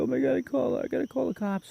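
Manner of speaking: high, panicked, near-sobbing woman's voice; frantic crying woman. Retold or imitated. Stranger at the crash scene, panicking on the phone, high frantic near-sob. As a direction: voice her as she falls apart on the phone. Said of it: "And she's crying".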